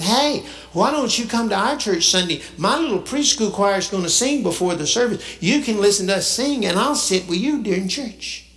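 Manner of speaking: put-on high, eager little boy's voice; little child's voice. Five-year-old boy inviting the garbage man to church, high child voice.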